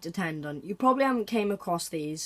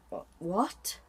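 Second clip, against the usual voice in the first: normal talking voice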